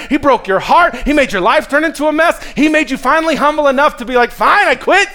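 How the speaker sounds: high, loud, driving preacher; full-force preaching. Real, not acted. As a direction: drive each point home at the top of your voice.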